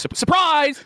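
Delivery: high, loud mock announcement; spring it on them cheerfully; mock surprise. Mocking Rich's surprise announcement.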